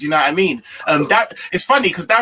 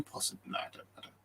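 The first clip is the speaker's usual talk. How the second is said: muttered aside; 23 dB quieter than usual. Real, not acted. Doubtful aside to self.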